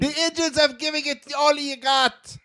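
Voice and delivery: slow, high, loud Scottish engineer voice; Scotty impression. Co-host imitating the Scottish engineer in a slow, loud Scottish accent. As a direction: ham up a Scottish starship engineer, slowly. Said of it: "That's Scotty".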